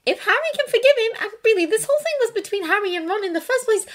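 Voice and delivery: high, hurried, loud argument; insistent. A narrator's reading. Hermione arguing for forgiving Ron, insistent. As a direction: rush the point out in a high voice. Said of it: "she insisted".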